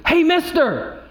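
high, urgent mock holler; urgent shout. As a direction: imitate a nurse calling out, pitched high. Nurse calls out in urgency.

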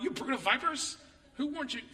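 high, playful quoting of the sign; mock sign voice. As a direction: read it like a funny church sign. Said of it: "A sign like when you drive".

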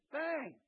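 high shouted bang like a knock; sharp bang. Acted. Preacher marking Jesus' knock on the disciples, sharp bang. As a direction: strike it like a knock on a door.

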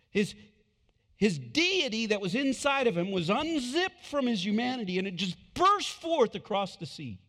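high, loud preaching climax; preacher thundering about the ghost; fiery preaching. Acted. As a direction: build the glory moment at full force. Preaching at full force.

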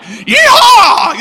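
high, loud cowboy holler; hammed-up celebration; mock triumphant whoop. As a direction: whoop it like a rowdy crowd. Bus full of friends cheering.

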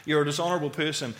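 quick, repeated accusing refrain; nagging accusations. Acted. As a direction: rattle it off like looping thoughts. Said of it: "these accusations flowing around my head".

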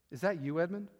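quiet, low question in the dark; hushed low voice. Acted. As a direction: ask it softly so nobody else wakes. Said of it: "said in a low voice".

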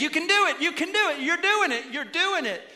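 high, imitated cheerleading; mock pep talk. Preacher pumping up the congregation for the week, mock pep talk. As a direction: pump them up in a high cheering call. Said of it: "who was pumping you up".

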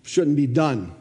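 usual speaking voice

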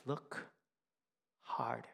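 soft, whispered command; hushed Rafiki. Retold or imitated. Rafiki tells Simba at the water, whispered and soft. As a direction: lean in and whisper it.

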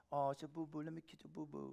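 put-on very soft babying voice; acted cooing over a small hurt; soft coddling. Father soothes kid's boo-boo, soft coddling. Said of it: "I admit I'm a little softer".

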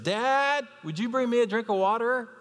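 put-on boy asking his dad for water; little boy voice. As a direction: ask sweetly like a small boy. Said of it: "the little boy said".

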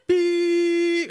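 long high steady beep tone; censor beep. Shown as a demonstration. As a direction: imitate a bleep censor. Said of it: "beeping out a lot of".

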